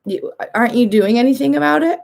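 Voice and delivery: mock disbelief at a sick friend; imitated judgment. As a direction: play the judging colleague, voice rising. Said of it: "the judgment starts to set in".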